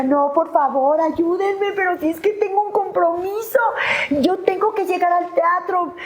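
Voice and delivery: súplica muy aguda y desesperada; gritando desesperada. Retold or imitated. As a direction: como loca, rogando que la ayuden. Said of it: "yo empecé como loca, empecé a gritar". (súplica desesperada y aguda)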